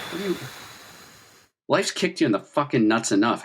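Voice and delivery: ordinary talk, nothing marked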